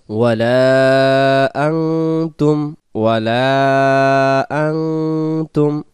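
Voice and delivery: أنتم with a nasal ng, slow; nasal ang sound. Shown as a demonstration. Teacher demonstrating the ang nasal sound, slow. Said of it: "এখানে উচ্চারণ হবে আং".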